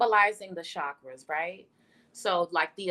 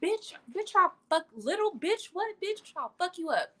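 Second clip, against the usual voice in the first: much higher, laughing mock rage; hammed-up fury; 9 semitones higher than usual. Clapping back at a doubter, high mock rage. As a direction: squeal it like a comic angry diva. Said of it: "That's how you should feel".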